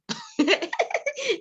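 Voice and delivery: a high burst of laughter; a high laugh after the mock fight. Real, not acted. Laughing after acted reply.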